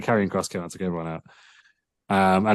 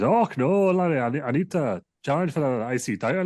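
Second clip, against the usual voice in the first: put-on raised Geordie accent, pleading to Larry; 7 semitones higher than usual. Drew asks Larry for the title shot, in a high Geordie accent. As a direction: do Drew as a whiny Geordie lad. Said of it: "that was Geordie".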